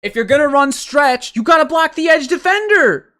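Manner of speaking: very high, loud, pleading rant; exasperated yell. Real, not acted. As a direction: shout it at the team in despair. Ranting about the failed stretch play.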